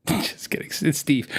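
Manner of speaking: a quick whispered correction; whispered aside. Real, not acted. Teasing the podcast audience with a whispered aside. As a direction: lean in and whisper the name like a joke.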